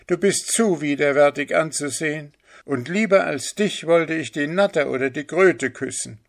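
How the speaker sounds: high voice full of disgust; disdainful boy. Acted. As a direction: spurn the mother with contempt. (verächtlicher Junge, hohe Stimme)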